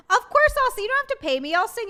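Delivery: high, loud eager promise; eager reply. Real, not acted. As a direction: jump in to offer the song.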